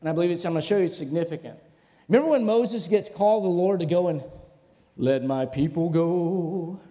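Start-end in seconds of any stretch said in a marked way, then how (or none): 5.0-6.8 slow, drawn-out mock-solemn command; solemn proclamation. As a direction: stretch it out like a grand proclamation. Quoting command given to Moses.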